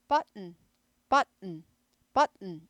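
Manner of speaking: 버튼 with no popped t, a stop then n; 버튼 said as but, a stop, then a nasal n; American button. Shown as a demonstration. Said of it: "미국 영어는 버튼 하지 않고 트 발음 튀는 거 싫어합니다".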